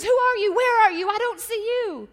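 high, loud questioning cries; panicked calling out. Acted. Man on the cliff to a hidden voice, high frantic calling. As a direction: call up to someone unseen. Dangling man calls to unseen voice.

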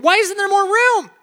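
high, loud, exasperated rhetorical question. Real, not acted. Fed-up traveller ranting to the congregation about cabin space, exasperated and loud. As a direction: throw the question up in disbelief.

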